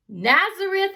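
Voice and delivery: high, loud exclamation of disbelief; exclaiming. A narrator's reading. Nathanael reacting to Philip, exclaiming in disbelief. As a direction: blurt it out in shock. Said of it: "exclaimed Nathanael".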